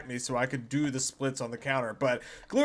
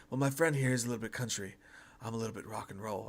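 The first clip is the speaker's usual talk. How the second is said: low, much softer, quick murmured Seagal line; hushed Seagal impression; 5 semitones lower, 10 dB quieter, faster than usual. Cohost quoting Seagal's hostage line, hushed and quick. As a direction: hush it down and rattle it off like Seagal. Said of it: "he's like".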